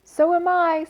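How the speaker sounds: high tiny spider voice. Acted. Second little spider answering Wilbur in a tiny voice. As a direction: squeak it up small. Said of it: "said another tiny voice".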